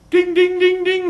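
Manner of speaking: rapid high, loud dinging; imitated bell. Shown as a demonstration. Trainer sounds the jury's points changing, high loud bell imitation. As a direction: ring out like a scoreboard bell.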